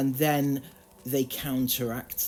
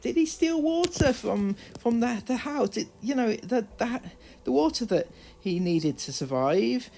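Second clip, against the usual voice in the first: much higher, rising incredulous outrage; outraged disbelief; 8 semitones higher than usual. Real, not acted. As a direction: ask it in shocked disbelief, pitch climbing. Outraged at the boy being punished.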